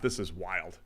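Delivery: ordinary talk, nothing marked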